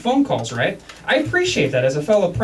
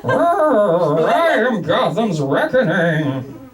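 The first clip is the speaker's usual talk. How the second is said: very slow, heavy villain voice; Bane voice; much slower than usual. Retold or imitated. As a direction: boom it out like Bane. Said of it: "So I started doing Bane".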